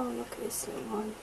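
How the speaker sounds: fast soft whisper. Real, not acted. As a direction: hush it like a secret find. Unboxing, hushed delight.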